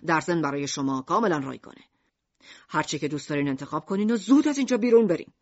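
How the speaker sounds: low salesman voice hurrying a customer out; Mishima's low pushy sales voice. Acted. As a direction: rattle off the wares to get rid of him. Mishima offers suicide tools.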